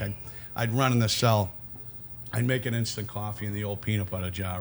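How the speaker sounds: ordinary talk, nothing marked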